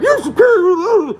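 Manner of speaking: high, slow, sneering mock voice; mocking mimic. Mimicking a smug mask wearer, high and drawn out. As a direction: drag it out like a smug prig. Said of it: "when someone's like".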